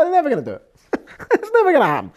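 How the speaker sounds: high, loud scoffing voice; hammed-up scornful angels; mocking angels. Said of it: "The Malachos are like".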